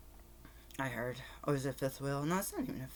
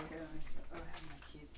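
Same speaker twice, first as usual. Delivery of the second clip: ordinary delivery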